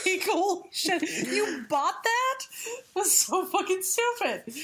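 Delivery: high laughing disbelief. Real, not acted.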